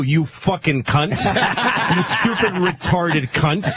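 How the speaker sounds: laughing through a slow mock insult. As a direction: spit it out while cracking up.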